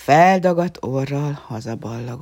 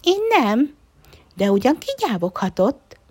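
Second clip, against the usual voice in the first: high, louder mousey voice; a tiny mouse protesting; squeaky mouse voice; little mouse; 8 semitones higher, 6 dB louder than usual. A narrator's reading. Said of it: "cincogta a kis egér".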